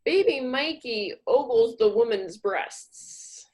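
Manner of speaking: ordinary delivery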